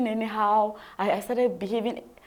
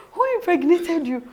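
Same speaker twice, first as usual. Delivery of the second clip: higher and louder, laughing through the question; laughing retelling; 8 semitones higher, 6 dB louder than usual. Retold or imitated. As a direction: repeat it while cracking up. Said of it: "I said".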